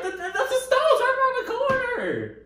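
high, laughing imitation of Anthony; mocking Anthony. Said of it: "he be like".

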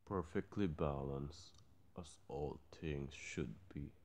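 low, soft villain voice; low and calm like Thanos. Retold or imitated. Thanos quote.

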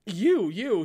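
hurried, loud reply; quick eager answer. A narrator's reading. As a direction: blurt it out fast. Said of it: "he quickly answered".

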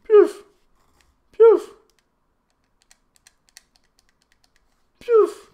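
mock deflating noise. Real, not acted. Mocking noise after final whistle.

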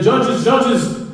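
high, loud Eastern European broken English. Retold or imitated. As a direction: call out in halting immigrant English. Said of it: "in a very broken English".

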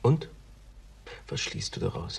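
almost soundless, under the breath; murmured whisper; whispered question. Acted. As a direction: ask it in a hushed whisper. Colleague's quiet question.

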